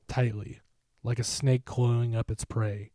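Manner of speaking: plain reading voice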